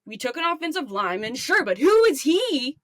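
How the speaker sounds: high-pitched, exasperated sports fan rant; upset caller. Acted. Fan calling sports radio about draft pick, angry rant. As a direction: rant at the radio host, incredulous and heated. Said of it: "upset about".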